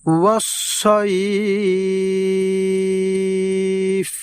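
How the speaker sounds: والصيف with the ay drawn out very long; long vowel. Shown as a demonstration. Teacher shows students the long drawn والصيف. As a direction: stretch the final syllable of والصيف slowly. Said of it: "লম্বা উচ্চারণে পড়ব।".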